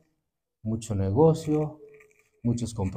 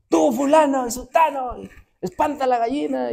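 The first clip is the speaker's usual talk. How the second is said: bossy shouting mother; 11 semitones higher than usual. Retold or imitated. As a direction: bark orders like a fussy mother shooing the hens. Mother bossing everyone during study.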